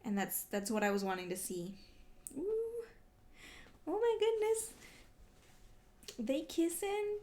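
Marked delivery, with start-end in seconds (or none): 2.4-7.2 delighted squeal. Real, not acted. Reacting to characters kissing.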